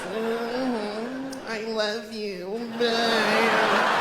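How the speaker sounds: high, soft, slow farewell; dying old person. Acted. Dying old in a bed, frail gentle goodbye. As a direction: fade out peacefully on a deathbed. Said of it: "just die old in a bed".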